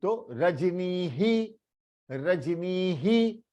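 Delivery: रजनी ही with the ह echoing the ई; visarga echo. Shown as a demonstration. Teacher demonstrating the visarga after ई.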